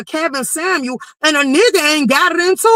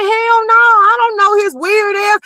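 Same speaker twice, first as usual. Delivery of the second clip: mock outraged yell; retold outburst; 6 semitones higher, slower than usual. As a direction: blurt it out indignant and near tears. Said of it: "I'm like".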